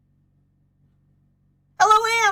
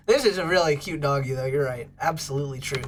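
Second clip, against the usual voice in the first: usual speaking voice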